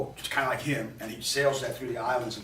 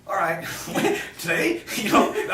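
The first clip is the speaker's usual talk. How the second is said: laughing; 7 semitones higher, 6 dB louder, slower than usual. Real, not acted. Laughs at the eight-hour drive.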